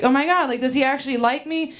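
fast, high, spiralling inner panic; anxious inner voice spiralling about a hookup; anxious racing thoughts. Retold or imitated. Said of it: "I get, like, a lot of racing thoughts".

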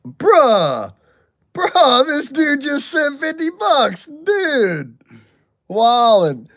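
high, loud disbelieving shout; excited yell. Real, not acted. Streamer yelling at a fifty dollar donation, high and excited.

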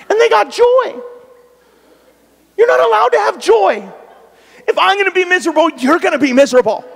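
high, loud, fast mock outrage at their joy. Preacher to congregation, loud high mock outrage. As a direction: rant like a sulking child who can't stand their joy.

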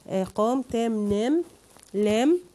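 a teacher lining up rhyming words, one beat per word; listing rhymes with stress. Shown as a demonstration. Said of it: "كل دي كلمات على نفس وزن عام".

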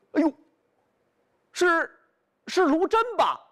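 glad surprise. Acted. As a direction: call out relieved through the door.

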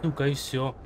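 ordinary delivery

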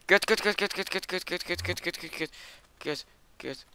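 high, rapid-fire panicked cursing. Real, not acted. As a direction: repeat it high in a panic, easing off in volume. Panicking as mob attacks in game. (hoog, paniekerig gevloek)

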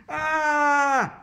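long, high, wailing scream; shouted scream. A narrator's reading. Boy gets buckled into the chair, screaming. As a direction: scream "Aaah!" in loud panic. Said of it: "I shouted".

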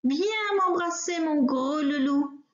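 a smothering lady pig calling her darling; cooing pig. Acted. Miss Pig smothering the wolf with kisses, syrupy coo. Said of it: "dit à son tour mademoiselle Cochon".

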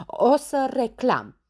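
high, loud, slow threat; shouted protest. Acted. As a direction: wail it as a threat. (amenințare strigată, tărăgănat)

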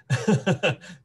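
quick amused laugh; a light chuckle at the joke; brief real laugh; short laugh. Real, not acted. Host laughs at own joke.

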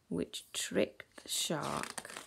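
slow, half-whispered reveal; hushed storytelling. A narrator's reading. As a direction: drop low and slow into a whisper.

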